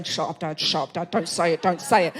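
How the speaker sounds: low, hushed plea under the breath; soft, low-pitched repeated pleading; embarrassed teen hushing. Acted. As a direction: quietly shush your dad, mortified. Embarrassed teenage daughter hushing her dad.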